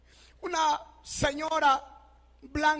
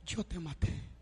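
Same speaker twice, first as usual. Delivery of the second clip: much softer and lower, barely there; faint broken echo; 9 semitones lower, 9 dB quieter than usual. Retold or imitated. Brother's guilty confession repeated, faint and much softer. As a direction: let the words die away almost to nothing.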